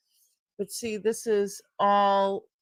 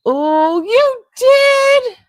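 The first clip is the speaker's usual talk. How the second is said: much higher and louder, thrilled; delighted surprise; 12 dB louder, much slower than usual. Real, not acted. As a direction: gasp out the happy surprise. Reacting to chemo news.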